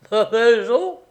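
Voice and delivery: loud, high outraged question; roaring. A narrator's reading. Polish man asked to sell his house, roaring. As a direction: roar it back as a question. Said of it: "Rugit le Polonais".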